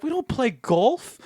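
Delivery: high, slow mock Scottish; Scottish panelist. Scottish guy on the panel objecting, mock Scottish accent. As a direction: protest in a put-on Scottish accent. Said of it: "a guy in the panel".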